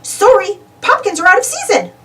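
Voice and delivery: lightly voiced kindly godmother; a touch of cheery old godmother; fairy godmother. Fairy godmother greeting Minnie-rella, kindly voice. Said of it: "says the fairy godmother".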